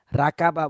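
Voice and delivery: "রাকাবা" with thin "ra-ka" instead of "ro-ko"; wrong pronunciation. Shown as a demonstration. Teacher showing what learners say, mispronounced "রাকাবা". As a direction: repeat the mistake "রাকাবা", all open aa vowels. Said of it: "রাকাবা না".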